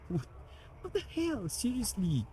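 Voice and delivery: high, incredulous what the hell; exasperated disbelief. Real, not acted. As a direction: squeak out total disbelief. Wheel's force feedback fails.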